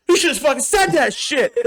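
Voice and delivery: high put-on voice, playful scolding; mock high scold. Bar guy telling him off, high mock voice. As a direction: squeak it out like a mock reprimand.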